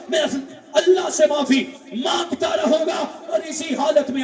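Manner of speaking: high, loud cries of shame; despairing penitent yelling to be left to die; anguished shouting. Acted. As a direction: shout it out like a broken man. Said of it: "بلکہ چیخ کر کہنے لگے".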